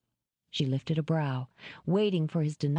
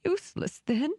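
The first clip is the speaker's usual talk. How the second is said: high, sobbing catch; hitching sob; 11 semitones higher than usual. Acted. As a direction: choke it out on a sob. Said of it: "she said on a hitching sob".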